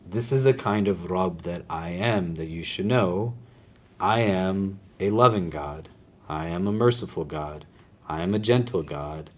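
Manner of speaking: soft, gentle divine voice; God speaking tenderly. Acted. God telling believers who He is, softly and tenderly. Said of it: "Allah is saying".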